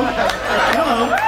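ordinary talk, nothing marked

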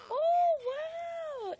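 high, loud delighted oh wow; big happy exclamation; excited wow. Real, not acted. Praising a toddler over video.

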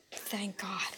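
breathy whispered relief; relieved whisper. Real, not acted. Relief after scare.

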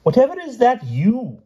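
very high, incredulous query; baffled knight. A narrator's reading. Knight questions the king's odd letter, high and baffled. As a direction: ask it high in bewilderment.

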